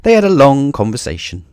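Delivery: ordinary delivery, nothing marked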